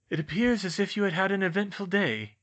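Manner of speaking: high-pitched, knowing headmaster; Dumbledore's voice. A narrator's reading. Dumbledore knows exactly what they'd been up to, knowing headmaster voice. Dumbledore addresses the caught students.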